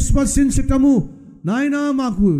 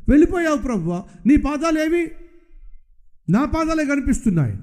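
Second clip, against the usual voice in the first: higher, complaining plea to the Lord; pleading believer; 6 semitones higher than usual. Acted. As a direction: cry out like a hurt believer, higher. Acted dialogue, believer pleads with God.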